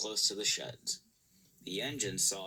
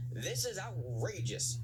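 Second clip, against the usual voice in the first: mild fuming; 8 semitones higher than usual. A narrator's reading. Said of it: "fumed Gordon".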